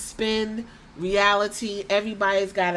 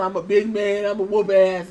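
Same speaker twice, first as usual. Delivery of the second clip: mock big man; 5 dB louder than usual. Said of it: "He wasn't putting on".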